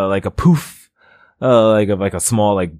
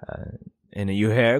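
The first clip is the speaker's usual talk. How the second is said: ordinary delivery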